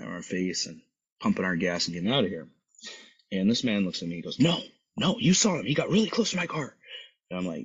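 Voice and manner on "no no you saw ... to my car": high-pitched, agitated imitation; retold indignant protest. Upset car owner argues with friendly bystander, indignant.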